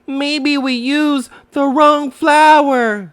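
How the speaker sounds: high, slow, hesitant little kangaroo; little Roo suggesting. Acted. Roo suggests to Pooh the flour was wrong, high and slow. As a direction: wonder aloud like a small child. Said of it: "suggested Roo".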